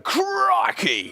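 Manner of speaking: high, excited Aussie exclamation of crikey; hammed-up Australian crikey; Steve Irwin crikey. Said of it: "just imagine a proper Steve Irwin".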